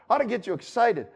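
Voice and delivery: ordinary delivery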